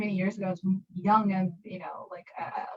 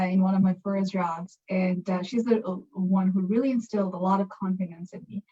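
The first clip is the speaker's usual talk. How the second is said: ordinary talk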